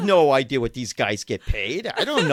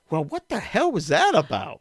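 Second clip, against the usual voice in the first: mock baffled; 7 semitones higher than usual. Baffled after agent hangs up.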